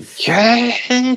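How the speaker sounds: drawn-out, high vacuum squeal; imitated vacuum whine. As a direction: stretch out a high motor whine. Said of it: "すごい音するんで".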